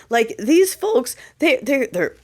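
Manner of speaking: ordinary talk, nothing marked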